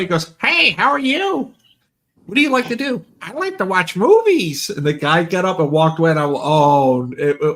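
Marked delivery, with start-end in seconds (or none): 0.4-4.7 much higher mock guest voice; funny voice. Imitating the funny stream guest to his friend. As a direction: squeak out the guest's lines for laughs. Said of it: "The voice was funny".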